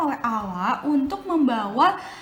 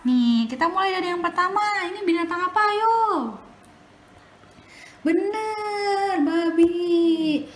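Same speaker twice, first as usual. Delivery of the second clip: put-on bright, sing-song voice for small kids; reading to a toddler; 5 semitones higher, 6 dB louder than usual. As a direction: coax the little ones along. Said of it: "quizzing children on animals".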